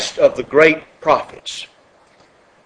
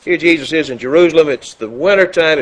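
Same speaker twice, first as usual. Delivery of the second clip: ordinary talk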